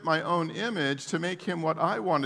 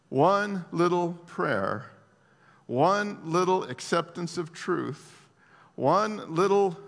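slow emphatic build; slower than usual. Acted. Preacher building slowly on repeats.

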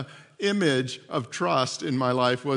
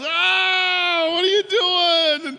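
high, loud, drawn-out mimicked howl; pained yell; 13 semitones higher, 7 dB louder, much slower than usual. Dad bitten on the ear by his son, mimicked yell. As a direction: wail it out slowly in mock outrage. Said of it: "and he yells".

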